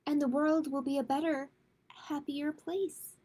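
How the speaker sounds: gentle, measured picture-book read-aloud; reading to children. A narrator's reading. Librarian reading a kindness book to kids, gentle read-aloud.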